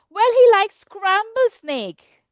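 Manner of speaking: loud, high, slow storybook mouse; mouse's teasing reply. Acted. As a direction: raise it and stretch it out slowly. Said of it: "then the mouse says".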